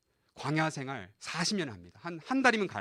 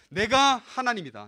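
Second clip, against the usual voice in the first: slow, raised proclamation; God's declaration; 4 semitones higher, 6 dB louder, slower than usual. Retold or imitated. God declaring himself to the Israelites, slow raised proclamation. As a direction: declare it slowly and firmly as God. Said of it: "이스라엘 백성들한테".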